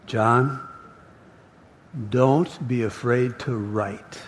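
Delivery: low, soft, slow reassurance; name first, a long pause, then gentle encouragement; hushed, unhurried divine comfort; Jesus. Acted. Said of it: "that voice is talking to John".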